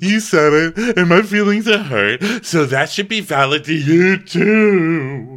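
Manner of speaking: protest, wounded and loud; indignant whine. Retold or imitated. Dorinda insisting her hurt feelings count, loud indignant.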